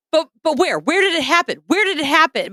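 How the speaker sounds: high, urgent repeated mock pleading; panicking. Teen demands sister tell where it happened, high mock panic. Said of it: "My response was to panic".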